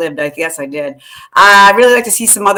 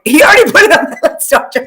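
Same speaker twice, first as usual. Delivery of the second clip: laughing; 9 semitones higher, 5 dB louder than usual. Retold or imitated. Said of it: "I'm like".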